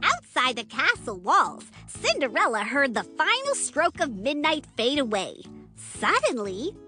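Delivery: ordinary delivery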